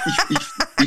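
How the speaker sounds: words break up in a high laugh; giggling mid-oath. Real, not acted. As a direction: laugh through the stammer.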